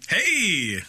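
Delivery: sudden high hey; excited shout. Real, not acted. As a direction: bark it out happily. Answering to his nickname.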